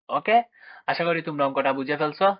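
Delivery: normal talking voice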